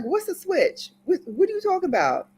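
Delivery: put-on high-pitched baffled kid questioning; cheeky, high back-talk; mock bewilderment. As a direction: play a child squeaking back in disbelief. Child talking back to threatening grandmother.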